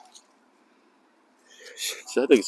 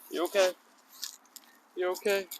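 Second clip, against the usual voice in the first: tender check-in; 11 semitones higher, 9 dB quieter than usual. Real, not acted. Checking on dog gently.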